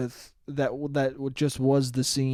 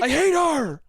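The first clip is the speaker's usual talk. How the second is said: higher, hammed-up grumbling ex; mock angry rant; 11 semitones higher, 4 dB louder, slower than usual. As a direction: play the offended ex muttering. Mocking the ex-boyfriend's rant.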